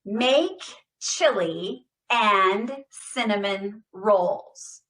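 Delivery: slow, loud, every word hit with intention; loud deliberate speech-therapy demo; every word stressed. Shown as a demonstration. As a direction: say each word fully and clearly. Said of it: "Go with me".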